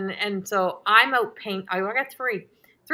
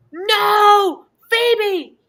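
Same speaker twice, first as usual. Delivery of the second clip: loud, much higher panicked yell of the name; retold shout; 12 semitones higher, 9 dB louder than usual. Owner yelling at her cat to drop the bat, panicked shout. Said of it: "And I'm like".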